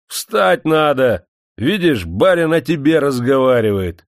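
громко, очень медленно, властно; гаркнуть приказ и давить голосом; крик. Acted. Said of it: "закричал на него хозяин". (хозяин продолжает кричать на крепостного)

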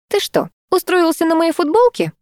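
high-pitched indignant question; indignant surprise. A narrator's reading. Owner catching her cat on her T-shirt, indignant surprise.

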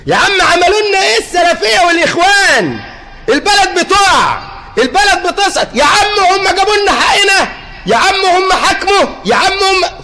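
shouted high complaint, imitating the youth; mock angry complaint. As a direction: yell it like a fed-up young man. (صراخ شاب غاضب بتقليد ساخر)

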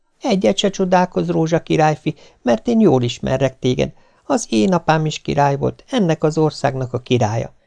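a maiden pleading her sad story; princess voice. Acted. (magasabb hangú királykisasszony, panaszos mesélés)

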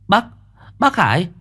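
a scared kid bursting in with bad news; panicked child. Lũ trẻ hốt hoảng chạy vào báo. (giọng trẻ con hoảng hốt, cao vút)

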